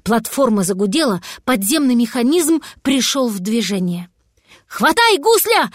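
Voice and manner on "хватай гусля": high, forceful yell; yelled command. A narrator's reading. Cat ordering the dragon to grab hold, high urgent yell. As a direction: yell it out urgently, pitch leaping up. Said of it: "заорал кот".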